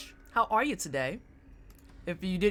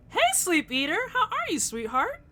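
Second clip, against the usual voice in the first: much higher, sweet put-on voice; sugary high greeting. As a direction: coo at the viewer like a sweetheart. Greeting a chat viewer sweetly.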